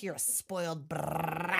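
slow mock scolding, last word stretched. Auntie Shrew telling Martin off, slow scolding. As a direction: draw out the insult, relish the final word.

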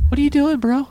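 high-pitched, startled what are you doing; the manager yelps in alarm, high up. Retold or imitated. Manager Dean stopping the teller, alarmed high voice.

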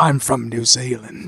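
low, slow, soft New Zealand attempt; New Zealand accent. Player introducing himself in accent roulette, tentative New Zealand accent. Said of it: "New Zealand Game of Thrones type accent".

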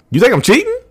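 high, incredulous question; put-on wounded astonishment; feigned disbelief. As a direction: act shocked at the accusation, pitch shooting up. Acted gaslighting of an accusing partner.

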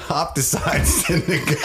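slow, high, collapsing into giggles; breaking into laughter. Real, not acted. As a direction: try to continue but crack up. Cracking up, has to restart.

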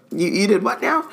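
high, amazed disbelief; incredulous. Retold or imitated. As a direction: play it as stunned disbelief. Imitating Amelia Bones incredulous.

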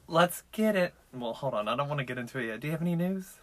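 ordinary talk

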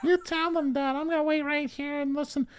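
put-on high, eager little boy; kid voice. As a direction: cheer dad on like a small kid. Kid voice, much higher.

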